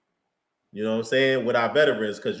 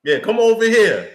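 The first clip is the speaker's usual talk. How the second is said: higher, louder recruiting call; mock holler; 6 semitones higher, 5 dB louder than usual. Rival team calling players over to death row, mock holler. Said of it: "other teams is gonna holler at them".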